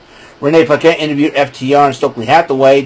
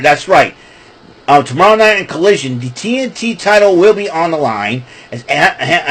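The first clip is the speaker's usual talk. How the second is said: normal talking voice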